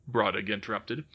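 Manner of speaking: ordinary delivery, nothing marked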